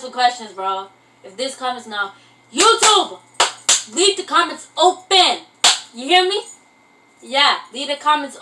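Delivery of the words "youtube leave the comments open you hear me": raised, emphatic order; loud demand. Real, not acted. Vlogger ordering YouTube to keep comments open, loud scolding. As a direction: lay down the law loudly.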